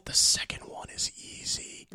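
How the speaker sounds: whispered, creepy joking confession; pretend-menacing hush; mock-sinister whisper. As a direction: whisper it like a playful killer. Mock-sinister joke about killing.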